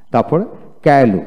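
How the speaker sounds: usual speaking voice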